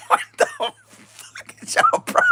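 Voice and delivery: words breaking into laughter; can barely get it out for laughing; laughing disbelief. Real, not acted. Laughs at the card.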